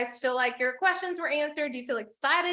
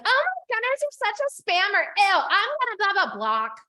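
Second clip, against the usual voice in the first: mock critic; 7 semitones higher, 4 dB louder than usual. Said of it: "they're like".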